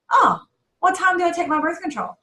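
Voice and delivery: high-pitched acted "oh" of discovery; mock realization. Retelling her reaction to the friend's question, mock realization. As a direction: act out a question suddenly dawning on you.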